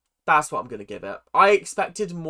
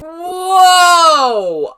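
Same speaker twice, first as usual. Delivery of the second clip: loud, high startled whoa; shocked shout; 12 semitones higher, 13 dB louder than usual. Real, not acted. Viewer startled by the shouting on screen, loud shocked whoa. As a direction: yelp in real surprise. Reacting to sudden screaming match.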